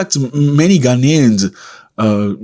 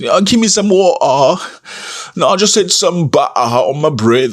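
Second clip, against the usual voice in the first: higher, hammed-up put-on British accent; mock British accent; 6 semitones higher than usual. Travellers back from Britain with a put-on British accent. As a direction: show off a fake British accent after two weeks in Britain. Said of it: "they come back with an accent".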